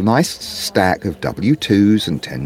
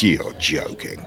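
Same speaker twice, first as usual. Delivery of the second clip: slow, low deadpan machine voice; a snowblower scoffing slowly; 4 semitones lower, 6 dB quieter, slower than usual. Retold or imitated. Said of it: "It just looked at me and said".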